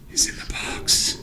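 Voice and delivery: hushed, breathy threat; ominous whisper. Acted. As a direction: whisper it like a secret doom. GM ominous aside, unvoiced.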